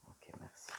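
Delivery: breathy whisper to the cards; whispered invocation. Real, not acted. Reader whispers her request to the oracle. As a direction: murmur the request and thanks like a prayer.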